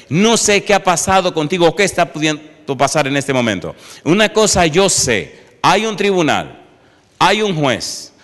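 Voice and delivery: loud, high fervent preaching; preaching at full force. Acted. Preacher thundering to the congregation about Christ the advocate, fervent. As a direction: proclaim it to the congregation.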